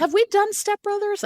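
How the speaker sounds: sudden high-pitched question; surprised. Real, not acted. Host suddenly asks co-host, high and surprised.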